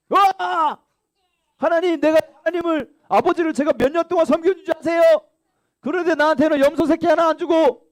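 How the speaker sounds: high, loud indignant protest, pitch climbing; a resentful older son venting at his father; angry complaint. Acted. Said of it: "막 화를 냈어요".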